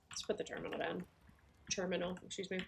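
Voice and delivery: talking with a mouth full of food; chewing between words. Real, not acted. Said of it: "Sorry, I'm eating and talking".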